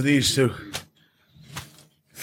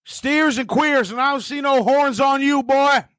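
drill sergeant impression; 8 semitones higher than usual. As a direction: bark it like a mocking drill sergeant. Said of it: "only two things I know".

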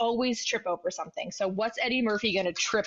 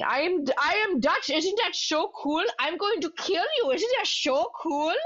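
higher-pitched mock Dutch accent; fake Dutch accent; 7 semitones higher than usual. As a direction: put on a silly, high fake Dutch voice. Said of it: "in his fake Dutch accent".

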